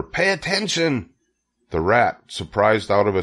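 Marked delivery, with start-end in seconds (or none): 0.0-1.1 high, urgent order; mild scream. A narrator's reading. Wilbur wakes the rat, mild screamed order. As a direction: insist in a high piglet voice. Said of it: "screamed Wilbur".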